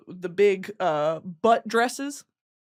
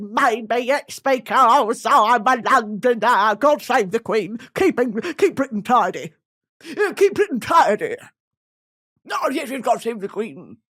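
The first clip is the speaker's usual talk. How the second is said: mock Cockney patriot; 5 dB louder than usual. Playing a patriotic Cockney woman.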